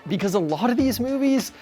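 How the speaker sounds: usual speaking voice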